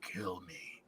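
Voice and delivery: a choked whisper from a man impaled on a pike; dying whisper. Acted. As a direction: barely breathe it out like a dying man. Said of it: "coughs up blood and says".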